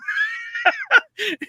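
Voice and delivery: high squealing laugh. Real, not acted. Laughing hard while telling a friend about sunglasses, high shriek. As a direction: squeal with laughter at your own story.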